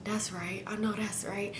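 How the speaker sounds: very soft, smiling under the breath; quiet smiling agreement. Real, not acted. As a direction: murmur approval while smiling. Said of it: "I'm just smiling like".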